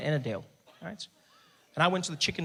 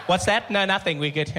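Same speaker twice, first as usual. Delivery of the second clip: loud office chatter; 4 semitones higher, 6 dB louder than usual. Pretend. Office chat overheard loudly.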